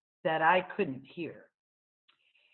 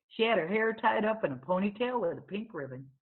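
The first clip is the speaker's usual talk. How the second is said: ordinary delivery, nothing marked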